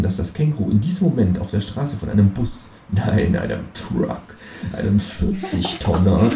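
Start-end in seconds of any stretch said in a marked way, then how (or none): 2.9-6.3 slow, low dramatic narration; ominous relish. A narrator's reading. Narrator dreams up truck for the kangaroo, slow ominous voice. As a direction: draw out each menacing correction.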